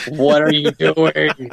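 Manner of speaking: high, drawn-out incredulous question; mock disbelief. Team rounding on their boss, mock disbelief. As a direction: slowly demand it in comic dismay. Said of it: "My whole team looks at me like".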